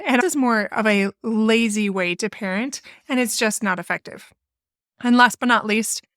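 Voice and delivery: ordinary talk, nothing marked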